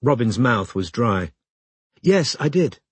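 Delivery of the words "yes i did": higher-pitched professional phone persona; lightly put-on, higher voice; Venetia. A narrator's reading. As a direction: answer as the made-up lawyer. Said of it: "said Robin as Venetia".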